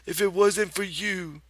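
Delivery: high, loud nagging aunt voice; scolding old aunt. Acted. Aunt Clara scolds Lenny, high nagging voice. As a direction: scold him like a fed-up aunt.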